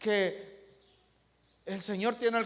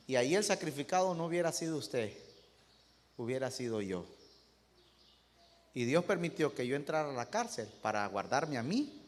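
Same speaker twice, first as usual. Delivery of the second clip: a quiet, humble servant answers his king gently; humble servant, hushed; 7 semitones lower, 6 dB quieter than usual. Acted. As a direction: speak softly, like a meek servant. (siervo humilde, voz baja y serena)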